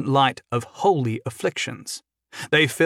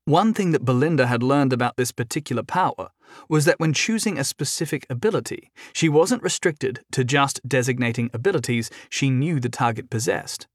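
ordinary delivery

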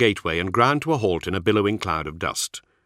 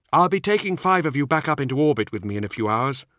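higher-pitched younger warrior voice; Nemiel; 7 semitones higher than usual. A narrator's reading. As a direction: lift the voice for the younger marine.

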